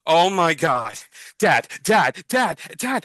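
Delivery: put-on high, loud, breathless kid calling his dad; excited kid. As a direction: tug at dad's sleeve, bursting with excitement. Kid spots his hero.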